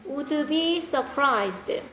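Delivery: very slow, each word spaced for note-taking; drawn-out dictation pace. Shown as a demonstration. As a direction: dictate it slowly. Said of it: "필기하세요".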